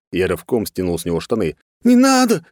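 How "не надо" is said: high, loud panicked cry; frightened yell. A narrator's reading. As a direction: shriek it in fear. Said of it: "испуганно заорал чувак". (испуганно заорать)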